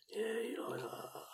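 drawn-out teasing breath; mock seductive whisper. Host finishing the pour, slow hammed-up whisper. Mock dirty talk to the drink.

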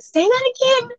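high, startled say that again; disbelieving demand. Retold or imitated. To the accusing lady, stunned disbelief. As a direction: ask her to repeat it in shock.

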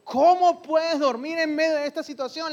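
grito alarmado, más agudo y fuerte; grito del capitán. Acted. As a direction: gritarle al dormido que despierte. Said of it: "le gritó". (el capitán despierta a Jonás a gritos, agudo y fuerte)

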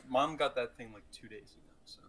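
normal talking voice

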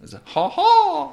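very high squeaky cartoon voice; cartoon mouse voice. Correcting his friend, he squeaks like a cartoon mouse. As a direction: pipe it up like a cartoon. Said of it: "the Mickey Mouse voice".

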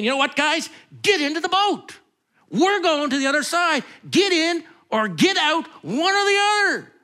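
a hammed-up commander giving orders; mock bossy ultimatum. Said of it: "that Jesus wasn't saying".

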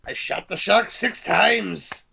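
dramatic movie quote. Pretend. Quoting movie line dramatically.

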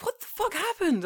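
very high shocked cry; shocked squeak. Retold or imitated. Reacting to the mystery toast, shocked. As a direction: squeal it in disbelief.

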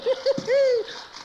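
high, booming belly laugh; a high, hearty chuckle; big hearty laugh. Acted. Jingles laughs at soaked boy.

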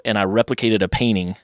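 usual speaking voice

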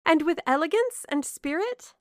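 high, loud, eager question; delighted. A narrator's reading. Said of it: "cried Marianne with sparkling eyes".

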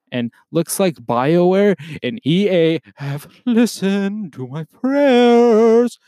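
rising excitement, slowing down; excited build. Real, not acted. Podcaster thrilled the game is finally coming, building excitement. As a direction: voice climbs and swells on prayers. Excited about answered prayers.

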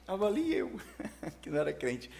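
high-pitched, breaking into a laugh; amused laughing. Real, not acted. Preacher joking about himself as an unbeliever, laughing. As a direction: say it with a real chuckle at yourself.

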